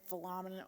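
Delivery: Philemon as "fuh-LAH-mun"; another pronunciation attempt. Shown as a demonstration. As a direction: try Philemon with AH in the middle, low and soft. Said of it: "listened to different pronunciations".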